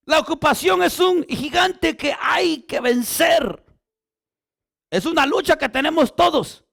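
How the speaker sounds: loud, high, fervent sermon delivery; full-force preaching. Acted. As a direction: preach it to the whole church, full voice.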